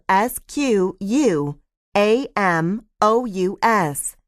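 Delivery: slow, separated letters; spelled out. Shown as a demonstration. Lecturer spelling squamous for the class, slowly letter by letter. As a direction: give each letter its own beat, slowly. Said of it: "You probably need that spelled out".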